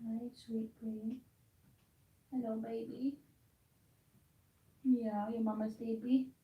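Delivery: hushed, tender cooing to the cat; soft pet talk. Real, not acted. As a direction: murmur to the cat in your arms.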